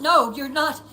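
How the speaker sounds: high, loud desperate cry; panicked denial. A narrator's reading. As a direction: shout it out in terror. Maggie denies in panic.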